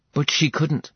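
plain reading voice

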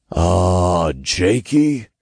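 drawn-out, low opening in imp voice; Todd hesitant. A narrator's reading. Imp taps the narrator's shoulder, hesitant. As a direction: stretch the first sound uneasily.

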